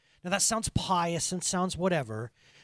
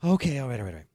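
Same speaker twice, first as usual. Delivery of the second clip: calming himself down; 4 semitones lower, 5 dB louder, much faster than usual. Real, not acted. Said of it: "I'm getting angry".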